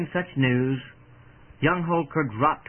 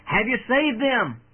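raised, higher-pitched call from the crowd; anxious cry; 7 semitones higher than usual. A narrator's reading. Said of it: "one voice from the anxious crowd cried out".

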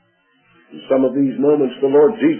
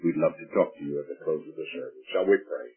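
normal talking voice

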